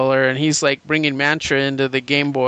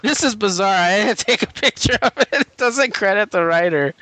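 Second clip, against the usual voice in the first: laughing, higher-pitched bafflement; amused disbelief; 5 semitones higher than usual. Real, not acted. Host baffled by a comic's missing writer credit, amused disbelief. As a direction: let laughter lift the words in disbelief.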